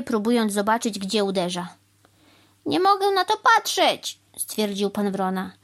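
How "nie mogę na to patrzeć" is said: high, loud dismay; appalled complaint. A narrator's reading. Crow unable to watch the cow hammer, appalled. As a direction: wail that you cannot bear it.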